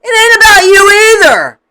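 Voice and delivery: loud, high, punched on about; emphatic shout. Real, not acted. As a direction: snap it out loudly. Repeats line, first take shouted.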